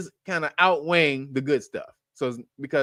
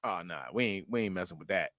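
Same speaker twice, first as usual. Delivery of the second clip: much lower, softer put-on voice; mock deep refusal; 12 semitones lower, 12 dB quieter than usual. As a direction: growl out a low dismissive no. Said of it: "If you say".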